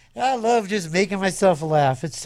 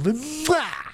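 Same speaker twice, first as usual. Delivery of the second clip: high smacking air kiss; mock kiss; 7 semitones higher than usual. DJ ending the game-show host bit with a high kiss. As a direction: blow a hammy kiss to close the bit. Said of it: "my Richard Dawson imitation".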